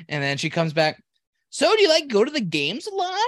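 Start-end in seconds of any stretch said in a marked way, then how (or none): 1.5-3.2 high, louder mock barista chatter; imitating the barista asking about games; chirpy barista impression. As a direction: ape her sing-song small talk.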